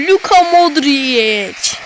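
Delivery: slow, loud stretched name call; drawn-out celebration. Real, not acted. Gamer hailing the goal scorer, drawn out. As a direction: drag out the scorer's name like a commentator.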